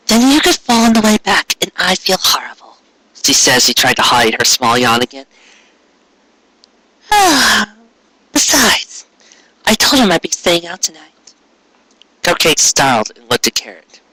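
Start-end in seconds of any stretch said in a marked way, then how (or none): 0.1-2.8 gentle mare Cupcake. Acted. Cupcake's voice in the story. 7.1-11.1 gentle mare Cupcake. Acted. Cupcake's voice in the story.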